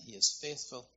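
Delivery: ordinary delivery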